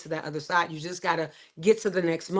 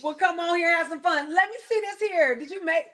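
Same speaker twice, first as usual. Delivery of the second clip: excited greeting; 8 semitones higher than usual. Real, not acted. Greeting her child arriving.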